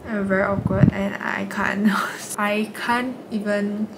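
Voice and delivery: muffled behind hand; hand over mouth. Real, not acted. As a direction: fingers pressed to lips. Seen 0: Hand fully covers mouth early, then fingers rest on lips.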